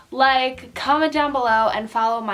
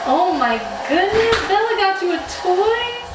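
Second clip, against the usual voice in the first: delighted surprise; 6 semitones higher, 4 dB louder than usual. Real, not acted. Delighted by puppy's toy.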